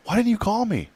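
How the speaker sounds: mock-startled friend on the phone; surprised doctor.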